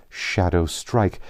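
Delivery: usual speaking voice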